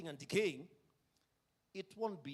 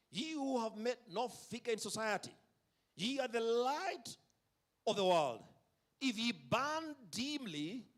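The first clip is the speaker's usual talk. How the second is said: quoting Spurgeon; 5 semitones higher than usual. Retold or imitated. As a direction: rise up like an old pulpit orator. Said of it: "had this to say on the subject".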